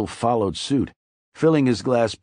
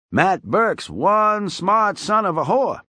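put-on higher, loud folksy old barfly voice; old drunk Weasel; 10 semitones higher, 4 dB louder, slower than usual. A narrator's reading. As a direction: play the chatty tipsy old-timer, pitched up.

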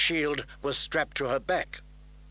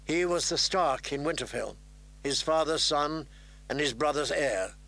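plain reading voice

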